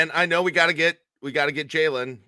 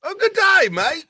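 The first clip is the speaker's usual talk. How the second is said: high, hammed-up Australian "good day, mate"; mock Aussie accent; 11 semitones higher, 5 dB louder than usual. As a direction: greet like a cartoon Aussie, pitched way up.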